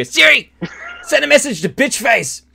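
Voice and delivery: high, loud shout breaking into laughter; laughing shout. Pretend. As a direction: yell the command at the phone while cracking up. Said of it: "so I can go".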